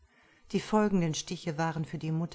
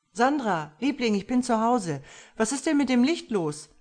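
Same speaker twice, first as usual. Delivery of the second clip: calling out; 7 semitones higher, 6 dB louder than usual. Acted. Husband calling up to his wife, loud and high.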